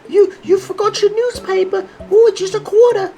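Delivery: very high, loud squeaky newsboy cry. Pretend. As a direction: holler like a tiny old-timey paperboy hawking papers. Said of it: "He goes".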